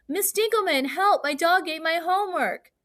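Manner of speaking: urgent, high-pitched exclamation; with feeling. Shown as a demonstration. Student exclaims to the teacher about lost homework, with strong feeling. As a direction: cry out for help with strong emotion. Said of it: "You would say it with feeling".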